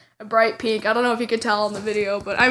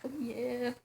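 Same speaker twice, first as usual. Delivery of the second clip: much softer, preening model thoughts; smug self-talk; 13 dB quieter than usual. Retold or imitated. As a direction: murmur it to yourself while posing. Voices her smug thoughts while posing.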